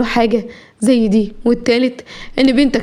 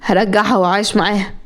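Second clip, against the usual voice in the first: plain reading voice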